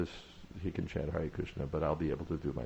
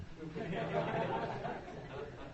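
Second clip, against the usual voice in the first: high laugh; 8 dB quieter than usual. Real, not acted. Laughs at wandering mind.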